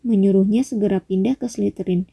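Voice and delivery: ordinary delivery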